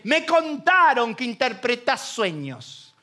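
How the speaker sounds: slow, contemptuous drawl; scornful. Acted. Pharaoh sneering at José the dreamer, contemptuous. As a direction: drag it out with contempt. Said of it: "y hasta despectivamente".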